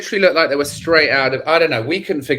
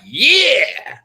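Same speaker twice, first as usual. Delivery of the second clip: a high, thrilled yeah; eager high yeah; excited yeah; 10 semitones higher than usual. Retold or imitated. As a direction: jump at the chance, delighted. Excited yes to hot friend.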